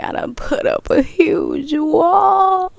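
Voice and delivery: high, slow anguished cry. Retold or imitated. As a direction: wail the realization, near tears. Just crying.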